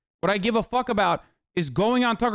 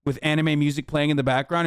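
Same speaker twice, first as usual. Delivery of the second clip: ordinary talk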